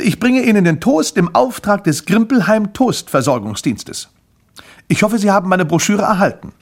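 high, loud official announcement; salesman's pitch. Acted. Grimpel delivers toast to his first customer, loud proud pitch. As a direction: proclaim it like an eager company representative.

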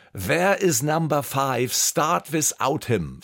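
put-on slow, heavy German-accented English; langsam, mit starkem deutschen Akzent. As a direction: clunk through the English line in a thick German accent. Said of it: "So richtig mit einem deutschen Akzent".

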